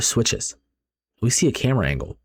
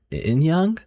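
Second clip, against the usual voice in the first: higher, rising questioning call; tentative call; 7 semitones higher than usual. Acted. Friend opens the door on her missing friend, tentative call. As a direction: ask the name uncertainly.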